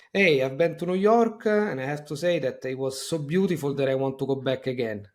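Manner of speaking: put-on Italian accent. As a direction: imitate an Italian cousin speaking English. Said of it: "She would go like".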